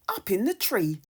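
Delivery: usual speaking voice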